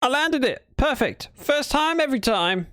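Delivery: high excited crowing; gleeful high brag; triumphant. Real, not acted. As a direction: crow about the perfect landing. Triumphant landing.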